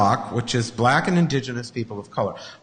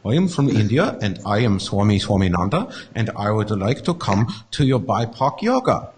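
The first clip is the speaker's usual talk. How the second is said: mock Indian swami, formal request; badly mastered Indian accent; attempted Indian accent; 6 semitones lower than usual. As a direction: put on an Indian guru's accent. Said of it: "You'd think I would have mastered the accent".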